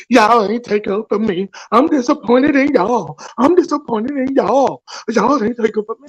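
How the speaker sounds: lightly put-on whiny voice, mocking her; a hint of a sulking friend; mocking Pam's complaint. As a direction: parrot her complaint back with a sneer. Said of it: "She in there, uh, me".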